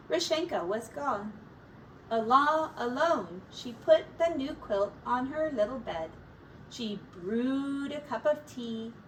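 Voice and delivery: usual speaking voice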